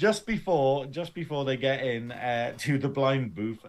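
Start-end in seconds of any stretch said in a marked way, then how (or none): none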